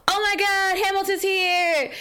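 high, thrilled fangirl squeal; excited squeal. Retold or imitated. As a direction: gush with delight. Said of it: "I know exactly how this is gonna go".

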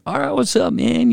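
cheery greeting. Retold or imitated. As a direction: chirp it, carefree and unaware.

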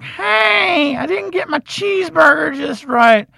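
high, whiny entitled brat; mock a spoiled kid complaining; squeaky, high spoiled customer; bratty kid voice. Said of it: "this little person would be like".

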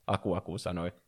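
ordinary delivery

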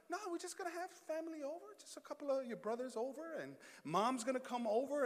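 very soft, quick imitation of wife; casual downplaying. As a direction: play it down innocently, hushed. Retells wife downplaying the party.

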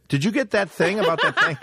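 high laughing mock Italian, botching the phrasing; flubbed wiseguy voice. Friend mocking a guy who botched the Goodfellas line. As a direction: ham up a clumsy mob impression. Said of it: "Instead, he goes".

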